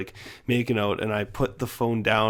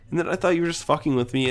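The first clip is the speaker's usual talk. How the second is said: usual speaking voice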